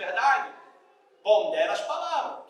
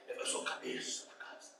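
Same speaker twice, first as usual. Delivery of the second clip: whispered, slow mock boast; mock macho whisper; 13 dB quieter than usual. Preacher mocking a macho man's boast, whispered. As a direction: sneer it under your breath like a smug husband.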